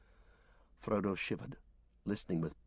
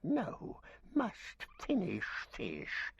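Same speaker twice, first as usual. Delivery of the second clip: Gollum voice; 11 semitones higher, much slower than usual. Acted. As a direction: croon and snarl like a starved cave creature gloating over fish. Gollum refuses again.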